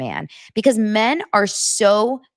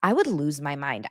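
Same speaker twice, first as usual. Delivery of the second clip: usual speaking voice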